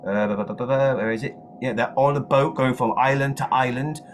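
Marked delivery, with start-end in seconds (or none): none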